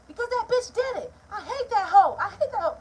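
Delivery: high, loud spiteful rant as mom; imitating mom's high, loud spite; hateful mother. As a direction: spit out the hate like a bitter mother. Mom rants to brother on phone.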